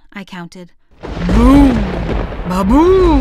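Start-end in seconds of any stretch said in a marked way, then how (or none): 1.3-3.2 booming loud thunder imitation. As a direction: shout it like thunder crashing.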